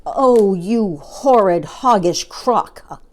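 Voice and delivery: loud, slow, drawn-out insult; indignant cry. A narrator's reading. Monkey cursing the child-eating crocodile, loud and indignant. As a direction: stretch out each insult in outrage. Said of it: "cried Mugglewump".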